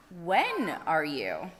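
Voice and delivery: slow, drawn-out wise question; a slow, pondering owl. Acted. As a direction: let each word hang like a wise owl.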